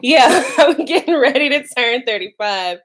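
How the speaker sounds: ordinary delivery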